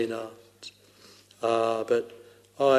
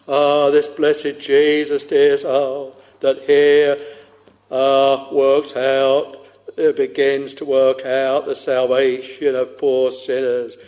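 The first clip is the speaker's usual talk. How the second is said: louder, ringing sermon voice; fervent preaching; 6 dB louder than usual. Acted. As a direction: lift it up, preach with fire. Preacher rising to full fervour.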